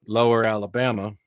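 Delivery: ordinary delivery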